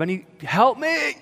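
high-pitched, mock-desperate cry for help; terrified plea. Praying alone in the van for work, terrified plea. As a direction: climb high as you beg. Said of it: "I'm terrified going".

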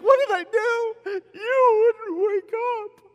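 high, loud, drawn-out wail; shrill mock cry. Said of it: "I'm like".

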